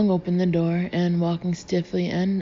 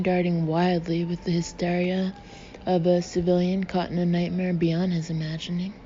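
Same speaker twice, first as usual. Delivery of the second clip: usual speaking voice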